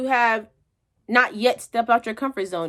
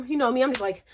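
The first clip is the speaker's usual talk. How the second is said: ordinary talk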